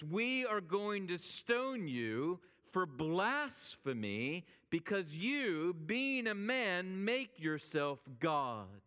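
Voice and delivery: slow, drawn-out accusing quote; emphatic retold accusation. Preacher quotes the accusers who told Jesus why, slow accusation. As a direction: deliver the charge slowly, weighting each phrase.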